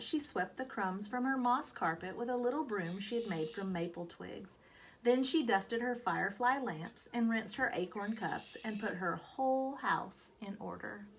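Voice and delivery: plain reading voice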